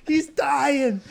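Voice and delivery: high, hammed-up alarm; mock alarmed girls. Sisters panicking over the maced boy. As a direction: squeal it like frightened girls. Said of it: "they're like".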